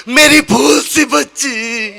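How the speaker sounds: slow, drawn-out lament; mock tearful father. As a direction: draw out every word like a doting, grieving father. Bride's father laments his daughter. (रुआँसे बाप का धीमा विलाप)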